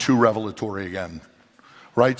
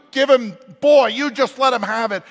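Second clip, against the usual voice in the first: higher, louder burst of mock anger; hammed-up letting him have it; high excited mock rant; mock venting; 11 semitones higher, 5 dB louder than usual.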